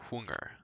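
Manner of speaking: "hunger" said with Swedish sung vowel; Swedish pronunciation. Shown as a demonstration. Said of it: "the reason I'm pronouncing it as hung".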